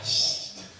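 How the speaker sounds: ordinary talk, nothing marked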